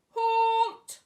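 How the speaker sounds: huge high loud shout; deafening bellow. A narrator's reading. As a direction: bellow it to stop them dead. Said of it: "who bellowed a deafening".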